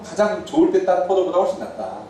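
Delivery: ordinary talk, nothing marked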